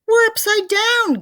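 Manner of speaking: very high, loud, slow gasp. A narrator's reading. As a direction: gasp it out in terror. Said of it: "gasped Mister Twit".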